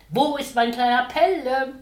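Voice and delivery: father calling loudly from the hallway. Acted. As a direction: call out cheerfully on arriving home. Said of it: "ruft wie immer".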